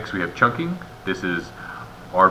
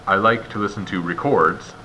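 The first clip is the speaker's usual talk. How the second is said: "records" stressed on the second syllable, re-CORDS; misplaced word stress. Shown as a demonstration. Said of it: "stress on the wrong part of the word".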